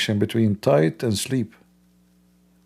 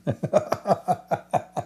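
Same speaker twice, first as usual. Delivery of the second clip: soft high laugh; 7 semitones higher, 5 dB quieter than usual. Real, not acted.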